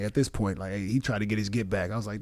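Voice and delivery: ordinary talk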